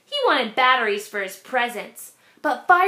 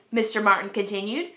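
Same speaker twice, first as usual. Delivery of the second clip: usual speaking voice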